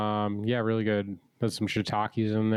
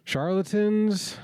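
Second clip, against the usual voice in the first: mocking echo; 10 semitones higher, much slower than usual. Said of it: "That's an expression".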